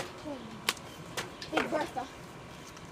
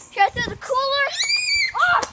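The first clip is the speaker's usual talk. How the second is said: shouting; 8 semitones higher, 5 dB louder than usual. Real, not acted. Shouting during game.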